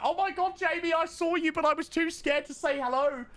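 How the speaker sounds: high, gushing starstruck fan voice, held throughout; gushing shy fan. Retold or imitated. A nervous fan messaging the streamer, high gushing voice. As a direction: play a nervous fan who was too scared to say hi. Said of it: "people that are like".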